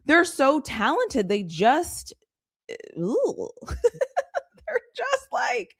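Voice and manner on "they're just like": high, slow laughing words. Real, not acted. Laughing at her own frustration with the Spurs. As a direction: laughs through the line in disbelief.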